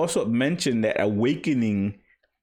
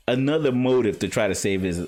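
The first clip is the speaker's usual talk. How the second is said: ordinary delivery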